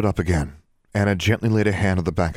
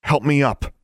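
raised, urgent command; 9 semitones higher, 4 dB louder than usual. A narrator's reading. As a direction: give it as a firm order. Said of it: "It was a command".

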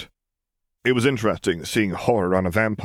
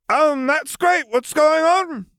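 put-on very high, louder girl's voice; confused Lily; 5 dB louder, much slower than usual. Lily asking the vampires, high and confused. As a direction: pitch it high and puzzled.

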